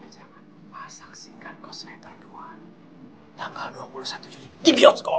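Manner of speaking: eerie ghostly whisper. Acted. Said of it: "yaitu dengan pura-pura jadi hantu".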